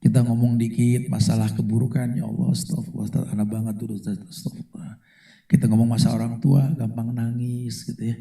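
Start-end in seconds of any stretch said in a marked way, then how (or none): 2.2-5.0 softer, teary imitation of a repentant newcomer; mock weepy new convert. Preacher mimics new convert crying to his teacher, softly. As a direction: lightly mimic a sobbing student. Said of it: "gampang banget nangis loh".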